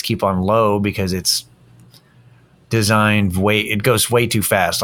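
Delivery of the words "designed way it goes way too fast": ordinary delivery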